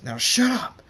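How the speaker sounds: loud, high angry outburst; angry shout. Acted. Zapper silences screaming Thunderstorm, angry shout. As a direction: yell to silence him.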